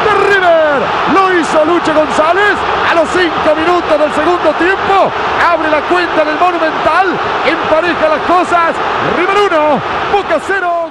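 loud shouted goal call; breakneck goal commentary. Real, not acted. Goal call, River vs Boca.

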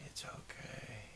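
soft, slow whispered comfort. Real, not acted. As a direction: barely breathe the words. Soothing sick partner.

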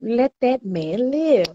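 drawn-out plea. Real, not acted. Defending Kwame to the chat.

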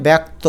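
ব্যক্ত as "baek-to"; open "ae" vowel. Shown as a demonstration. Teacher answering the question, ব্যক্ত as "baek-to". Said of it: "কী বলতে হবে".

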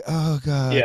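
high, whiny mock plea for sympathy; mocking attention-seeker. Mocking the attention-seekers he can't stand, in a high whiny voice. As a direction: parody a friend fishing for pity online. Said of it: "this little cryptic fucking".

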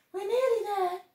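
a tiny animal encouraging; bush baby voice. Acted. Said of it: "said Bush Baby".

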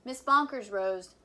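ordinary delivery, nothing marked

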